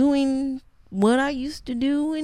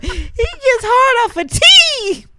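high, slow laughing disbelief; 13 semitones higher, 8 dB louder, slower than usual. Real, not acted. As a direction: squeal it out laughing. Incredulous mocking.

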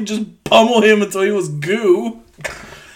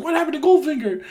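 high, softer mock character voice; Pussy Galore; 8 semitones higher, 4 dB quieter, much faster than usual. Heroine asking the spy about the villain, high mock voice. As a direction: lift the pitch for a quoted question. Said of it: "Pussy Galore's like".

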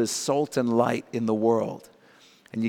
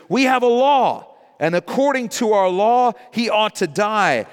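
accusing crowd; 8 semitones higher, 4 dB louder than usual. Acted. Preacher voicing accusing crowd.

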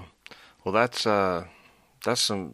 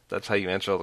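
usual speaking voice

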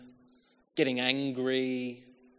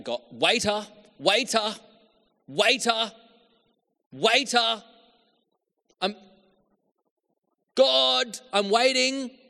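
high, loud pleading call; praying like calling a waiter; 11 semitones higher, 8 dB louder than usual. Retold or imitated. Believer praying impatiently to God as to a waiter, loud high calls. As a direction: cry out to God like an impatient diner. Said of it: "You've been praying the prayers. Like".

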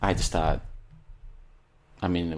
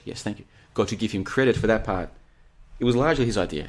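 normal talking voice

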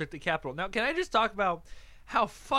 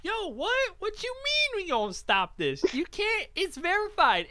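high, incredulous mock crowd voice; squeaky imitation of puzzled fans; mock baffled supporters; 13 semitones higher than usual. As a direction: play the confused rioters, pitched way up. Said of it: "And everybody's like".

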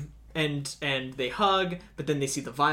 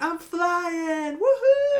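high, loud whoop of joy; excited shout; 6 dB louder, much slower than usual. Retold or imitated. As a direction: yell it like flying free. Retold flying joy, shouted.

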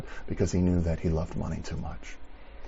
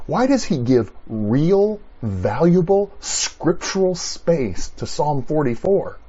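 louder, higher, slow indignant question; indignant preaching; 6 semitones higher, 5 dB louder, slower than usual. Acted. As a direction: preach it with outrage at the nonsense.

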